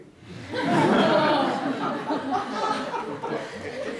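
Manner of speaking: loud, high burst of laughter; real laugh. Real, not acted. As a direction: laugh hard at your own joke. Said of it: "Just think about it".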